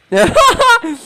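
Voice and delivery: very high, loud cackle; high burst of triumphant laughter; gleeful cackle. Acted. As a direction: cackle with glee. Gloating at the card.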